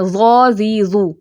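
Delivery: زا، زی، زو said with a buzzing z; ḍād with vowels, said as a z; wrong z for ḍād; ḍād turned into z. Shown as a demonstration. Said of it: "یہ کس کی sound آپ کو سمجھ آ رہی ہے".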